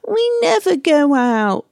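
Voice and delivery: put-on high, sulky kid voice; bored child whining. A bored kid complaining to a busy parent, high sulky whine. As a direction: whine it like a fed-up child the day after a treat. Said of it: "the kid comes to you, bored, fed up, and says".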